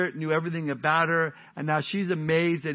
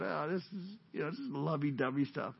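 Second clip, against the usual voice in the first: lovey-dovey; 10 dB quieter than usual. Acted. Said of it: "He's got".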